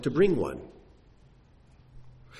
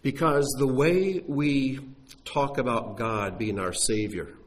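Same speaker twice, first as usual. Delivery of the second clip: usual speaking voice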